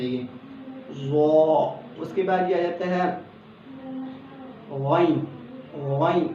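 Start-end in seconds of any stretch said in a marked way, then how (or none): 1.0-1.8 ضا full and round-mouthed; heavy ضا. Shown as a demonstration. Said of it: "منہ کی گلائی آ جائے". 4.7-6.3 غین gargled at the back of the throat; throaty غین; throaty ghain. Shown as a demonstration. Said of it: "غین ایسا لفظ ہے".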